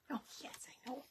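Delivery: soft whispered coo; whisper to the dog. Real, not acted. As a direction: whisper it close to the pet. Cooing to the dog.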